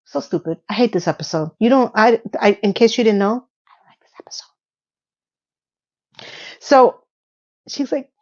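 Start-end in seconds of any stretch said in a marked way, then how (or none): 3.7-4.5 whispered confiding aside. Real, not acted. Reviewer confessing to viewers she hates the episode, in a whisper.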